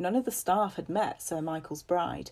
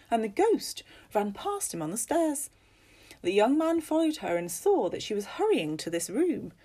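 high, emphatic, lilting storyteller; animated ghost-story telling with big pitch swoops; storyteller Missus Fox; 6 semitones higher, 5 dB louder than usual. Acted. Missus Fox telling guests about a ghost, animated storyteller.

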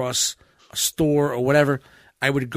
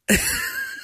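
very high squeaky laugh burst; shrill-pitched laugh burst; 4 dB quieter than usual. Real, not acted. Sudden laugh.

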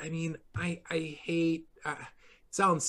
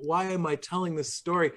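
usual speaking voice

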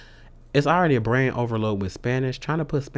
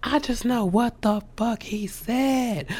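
dawn on you in disbelief; sudden realization; 12 semitones higher than usual. Retold or imitated. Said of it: "And then I was like".

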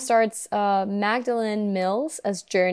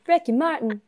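higher, imperfect Southern-belle try; attempted Southern accent; 6 semitones higher than usual. Host copying co-host's version, attempted Southern accent. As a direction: have a go at a Southern accent, not quite landing it. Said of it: "That wasn't it, but good try".

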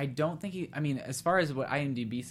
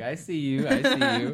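second one much higher and louder; playful teasing; 10 semitones higher, 7 dB louder than usual. Retold or imitated. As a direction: laugh through it, then squeal. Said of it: "I was like".

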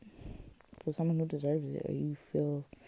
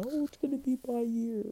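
mock hopeful; 9 semitones higher, faster than usual. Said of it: "I'm not gonna say".

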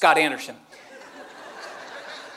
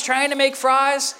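normal talking voice

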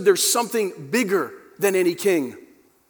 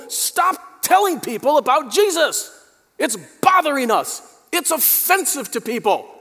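accusers' demand; 6 semitones higher than usual. Retold or imitated. Peter's accusers voiced.

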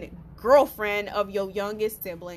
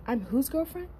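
put-on rising, disbelieving I'm whose girlfriend; incredulous question; 2 semitones higher, 6 dB quieter than usual. Quietly asking the cheating boyfriend, stunned disbelief. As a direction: ask it like you can't believe your ears. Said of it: "I said it just like that".

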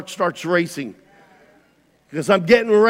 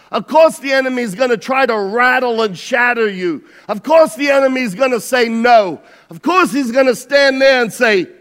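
loud, raised preaching voice; preaching at full force; 6 semitones higher, 7 dB louder than usual. Acted. Preacher rallying the church, loud and rising. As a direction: drive it home to the congregation.

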